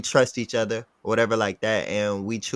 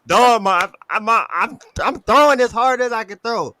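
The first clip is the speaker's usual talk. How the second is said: strained shout; 11 semitones higher, 9 dB louder than usual. Retold or imitated. Exhausted athlete answering the coach.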